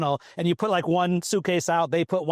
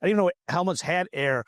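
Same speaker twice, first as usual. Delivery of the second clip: ordinary delivery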